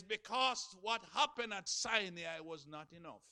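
loud, high, rising sermon cadence; preaching at full force. Acted. Preacher to his congregation, loud and fervent.

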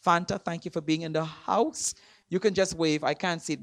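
ordinary talk

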